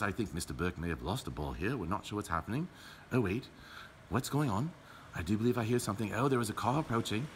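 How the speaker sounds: hushed commentary. Pretend. Commentator confiding about the car keys in hushed tones. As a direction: murmur like a golf announcer.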